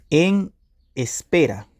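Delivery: each word set apart by a pause; slow dictation. Shown as a demonstration. Repeats meaning slowly, as if dictating.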